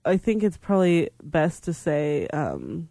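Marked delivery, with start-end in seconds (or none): none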